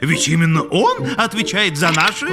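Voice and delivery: laughing through a cheeky joke; sly laughing. Acted. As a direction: tease with a coy pause before the word. Joking about instincts, laughing.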